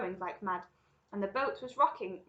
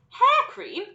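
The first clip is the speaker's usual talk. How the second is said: very high, loud questioning exclamation; incredulous cry; 15 dB louder than usual. A narrator's reading. Mike cries out at the odd item on the sign, incredulous. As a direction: echo it in disbelief. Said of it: "cried Mike T V".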